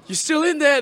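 high incredulous crowd voice; crowd disbelief. Retold or imitated. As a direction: gasp it like a shocked crowd. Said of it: "they're like".

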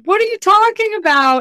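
high, loud mock disbelief; incredulous doubter. Freelancer quoting doubters with mock disbelief. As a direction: play the scoffing skeptic. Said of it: "people are like".

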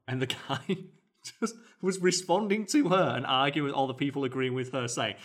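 normal talking voice

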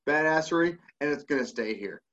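ordinary delivery

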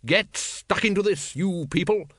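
bright. A narrator's reading. Woman urging dragons to eat, brightly. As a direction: call them brightly to their food. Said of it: "she said brightly".

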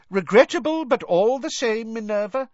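loud, high, pompous minister voice; loudly. A narrator's reading. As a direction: boom it out like an offended official. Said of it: "Cornelius Fudge was saying loudly".

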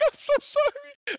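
put-on boy panicking after hitting a girl, frantic apology; freaking out apology. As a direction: beg forgiveness in a frantic squeal. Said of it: "I'm, like, freaking out".

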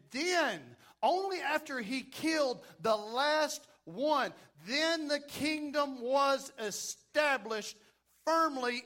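fervent sermon delivery; booming motivational speech. Acted. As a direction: preach it out with conviction. Preaching at full force.

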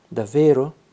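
a child lighting up with hope, lightly voiced; mildly eager, hopeful question; radiant. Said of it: "mi domandò radiosa". (radiosa, appena accennata)

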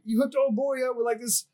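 high, indignant retold accusation; flipping out. Airman accusing his sergeant of a bad set-up, flipping out. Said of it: "he just flips out".

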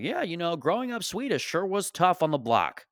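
mock a comic milking their background; mock stage comic. Comic mimics people who get up to talk heritage, mocking. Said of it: "You just hear these people, they get up and they're like".

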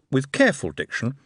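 ordinary talk, nothing marked

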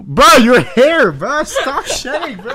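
high, loud outrage breaking into laughter; loud laughing complaint. Real, not acted. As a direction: shout in disbelief while laughing. Complaining about hair on the bed.

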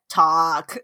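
low, stretched creature voice; slow mock creature croak; Ewok saying a word. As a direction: imitate an Ewok trying out a word. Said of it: "And then he's like".

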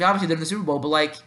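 usual speaking voice

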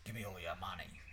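low, soft attempt at American that slips into Australian; failed American accent. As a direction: tentatively test out an American accent. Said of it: "I'll try it again".

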